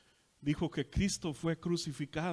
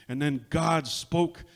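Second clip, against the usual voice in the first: loud, very slow habló Dios; booming refrain; 2 semitones lower, 8 dB louder, slower than usual. Retold or imitated. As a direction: proclaim it like the old preacher. Preacher's refrain in sermon retelling.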